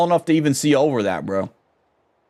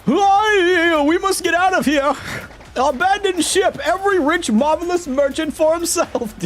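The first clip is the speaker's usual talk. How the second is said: pompous merchant panic; 13 semitones higher than usual. Acted. Merchant ordering everyone to abandon ship, hammed-up high. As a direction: declare it grandly in a fright.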